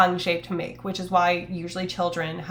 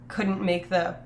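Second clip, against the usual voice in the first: ordinary delivery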